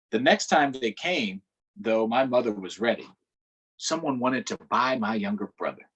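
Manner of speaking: steady read-aloud storytelling voice; story narration. A narrator's reading. Teacher reading a book aloud to children, narrating. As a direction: read the story to the class, measured.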